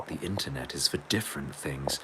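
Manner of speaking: breathy hushed whisper of the line. Shown as a demonstration. Streamer repeats the chat message as a mock-secret whisper.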